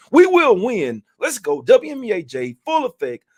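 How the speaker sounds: loud, high hype shout; rally speech. Real, not acted. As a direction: pump up the crowd at the close. Hype sign-off chant to viewers.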